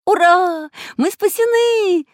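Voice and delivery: high, loud cheer, drawn out; jubilant shout. A narrator's reading. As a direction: cheer with joy at being rescued. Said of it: "закричал Чижик". (радостный крик)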